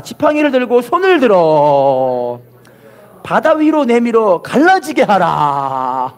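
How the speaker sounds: slow verse with long held endings; call-and-repeat proclamation. Shown as a demonstration. Preacher has congregation repeat the verse, slow drawn-out proclamation. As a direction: stretch out the last syllables of 들어 and 하라. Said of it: "따라합시다".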